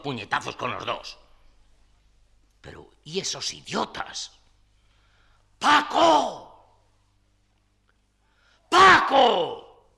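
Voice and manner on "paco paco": shouting down the stairwell; calling out. Acted. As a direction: call out loudly.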